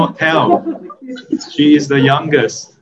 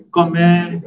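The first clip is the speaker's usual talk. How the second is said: "God meh." stressed on "meh", higher; emphatic frown; 6 semitones higher than usual. Shown as a demonstration. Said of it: "if you want to emphasize, use a frown".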